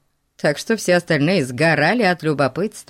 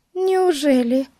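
put-on girl voice; 11 semitones higher than usual. A narrator's reading. Betsy voiced.

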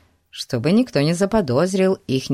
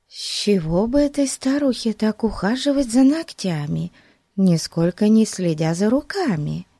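Betsy's thoughts; 5 semitones higher than usual. A narrator's reading. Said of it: "И она пристально вгляделась".

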